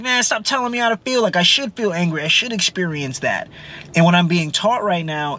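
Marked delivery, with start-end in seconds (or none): 0.0-3.5 pitched up high, fed-up pushback; exasperated inner retort. Retold or imitated. Silently answering mom, exasperated and high. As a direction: snap back at mom's advice in your head. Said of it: "in my head, I'm like".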